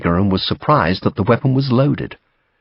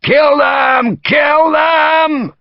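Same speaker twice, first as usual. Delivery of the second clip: high, loud, strained battle yell; officer's shout; 4 dB louder, much slower than usual. A narrator's reading. Officer urging the bayonet charge on, shouting. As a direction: yell it with full killing urgency. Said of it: "an officer of the Cauliflowers shouted".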